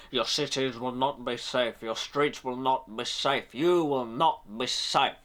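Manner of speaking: slow, low, menacing threat; slow, deliberate villain threat; menacing Magneto. Retold or imitated. Reviewer does Ian McKellen Magneto, slow low menacing. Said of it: "I'm going more Ian McKellen then".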